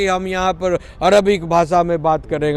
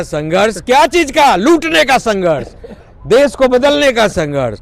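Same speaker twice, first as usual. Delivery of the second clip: higher and louder, indignant rising rant; indignant higher, louder tirade; heated outburst; 6 semitones higher, 5 dB louder than usual. Real, not acted. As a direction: fire off each struggle, getting angrier. Heated rant against opponents.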